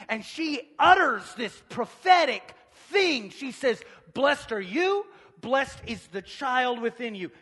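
loud, high, building preacher's cry; full-force preaching. Real, not acted. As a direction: preach it out, punching the key words.

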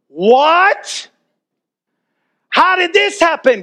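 high, astonished "what?"; stunned disbelief. Retold or imitated. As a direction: pitch it up in sheer amazement. Don hears case was dropped.

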